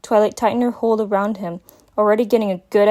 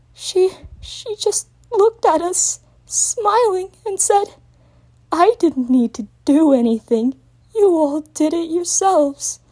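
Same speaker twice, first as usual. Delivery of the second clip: high, slow, shaky crying voice; holding back sobs; tearful recounting; 9 semitones higher, slower than usual. A narrator's reading. Young dragon tells Twilight the villain's words through tears. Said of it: "sobs made Spike's body convulse".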